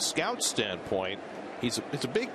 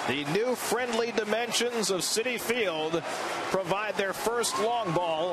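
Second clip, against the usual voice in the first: excited; 8 semitones higher than usual. Real, not acted. Afterglow of home run call.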